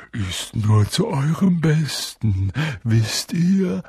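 slow, sly, sugary menace; Peeves, falsely gentle. A narrator's reading. Peeves mock-advising the children, falsely gentle. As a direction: pretend it is for their good. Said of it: "mit sanfter Stimme".

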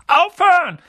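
high, loud, frantic order; commanding shout. A narrator's reading. Uncle suddenly finds his voice, commanding shout. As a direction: cut him off with a high yell. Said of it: "befahl er".

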